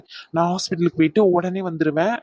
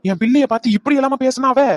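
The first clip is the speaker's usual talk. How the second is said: high-pitched, outraged question; offended, raised-pitch demand; indignant outburst; 6 semitones higher, 4 dB louder than usual. Acted. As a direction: flare up in disbelief on a child's behalf. Indignant reaction to reported insult.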